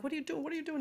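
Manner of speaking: high alarmed repeated question; a worried mother's urgent high plea. Retold or imitated. Mother alarmed at son calling from prison, high urgent voice. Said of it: "I'm like".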